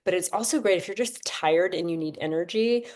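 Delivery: ordinary talk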